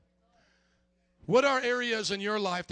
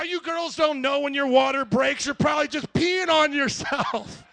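loud, raised-pitch know-it-all rant; dismissive scolding; 6 semitones higher, 7 dB louder than usual. Retold or imitated. Husband sending labouring wife back to bed, loud dismissive scolding. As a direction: brush her off loudly so you can sleep.

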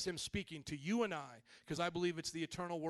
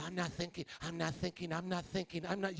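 lower, quick repeated mock chant; imitated meditator; 3 semitones lower than usual. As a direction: mimic someone insisting under their breath. Mimics someone straining not to think.

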